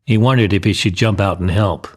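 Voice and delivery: ordinary delivery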